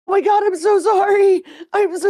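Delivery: high, loud, rushed apology after a prank gone too far; gushing mock-panicked sorry; frantic apology. Apologizing to the scared kid, high and frantic. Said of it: "all of us started laughing".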